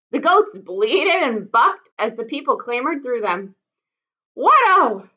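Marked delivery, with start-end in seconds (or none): 4.3-5.1 high, loud startled call; loud high cry of alarm. Acted. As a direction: call out in alarm over the goats. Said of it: "Fiduciary called out".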